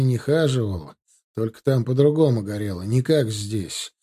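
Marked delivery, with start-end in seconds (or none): none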